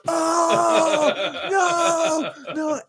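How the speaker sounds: very high, loud mock shout; imitated shouts of horror; crowd uproar. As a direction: howl like an appalled crowd. Crowd reacts in shocked uproar.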